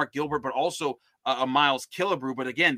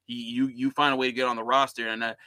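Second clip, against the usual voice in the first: normal talking voice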